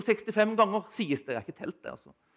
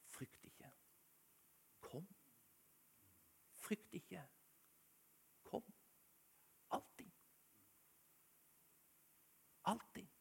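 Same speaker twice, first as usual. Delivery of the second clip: gentle whisper; 3 semitones lower, 23 dB quieter than usual. Pretend. God voiced inviting the fearful.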